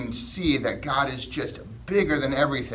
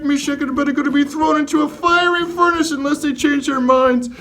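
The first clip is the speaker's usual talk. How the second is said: lightly voiced Daniel retelling his story; a hint of Daniel telling the kids; playing Daniel; 10 dB louder than usual. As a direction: tell it as Daniel, a bit brighter. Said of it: "I'm just pretending".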